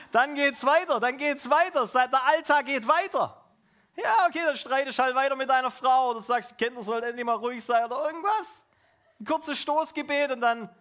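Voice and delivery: loud, high, driving preacher voice; fired-up sermon delivery, pushing each phrase; preaching at full force. Acted. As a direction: hammer it home to the congregation.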